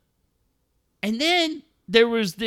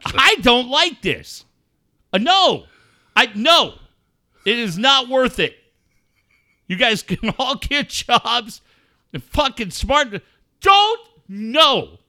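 louder, higher, appalled protests; loud disgust; 5 semitones higher, 5 dB louder than usual. Real, not acted. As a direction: recoil loudly as if sickened. Disgusted reaction to stream.

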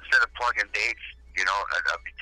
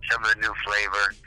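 ordinary talk, nothing marked

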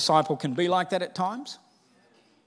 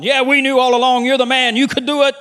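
much louder, higher, hammed-up boast; mock-confident cheering; 7 semitones higher, 10 dB louder than usual. Disciples as the preacher would have written them, hammed-up cheering. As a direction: cheer him on like a fan. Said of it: "Surely they should have gone".

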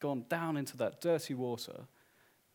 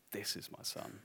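whispered, much softer; hushed whisper; 4 semitones lower, 13 dB quieter than usual. Acted. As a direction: breathe it like a sacred secret. Preacher voicing the Father's declaration.

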